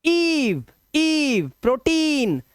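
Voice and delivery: ईव and प्रोटीन with high, long "ee"; long vowel sound. Shown as a demonstration. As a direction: stretch ई in ईव and टी in प्रोटीन. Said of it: "long vowel sound बोलते हैं इसको".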